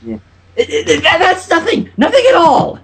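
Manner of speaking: high, stammering panic; flustered denial. Acted. Trixie hiding the rope from Twilight, high and flustered.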